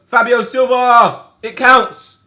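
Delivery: stretched, loud, high cry of the scorer's name; goal celebration yell. Real, not acted. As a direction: roar it like a fan in the stands.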